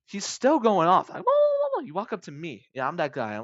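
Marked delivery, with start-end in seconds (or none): 1.2-1.9 very high, loud nonsense babble; mock-language babble. Customer still going off in an unknown language, babbling. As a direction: babble on in an invented tongue.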